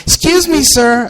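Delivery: high, prim polite lady voice; pointed excuse me, sir. Retold or imitated. Lady pressing the comedian, high prim voice.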